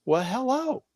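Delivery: high, startled return greeting; surprised hello. Retold or imitated. Mother caught off guard by stranger's pointed greeting, startled. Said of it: "And my mom went".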